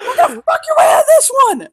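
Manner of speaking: high, loud mock-furious shriek; high-pitched acted tirade; angry yelling. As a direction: yell it way up high in outrage.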